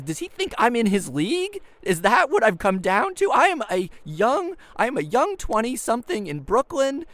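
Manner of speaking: high, loud outraged disbelief; indignant inner rant. Retold or imitated. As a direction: rant at yourself, offended he thinks you're his league.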